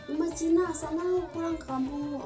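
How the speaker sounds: ordinary talk, nothing marked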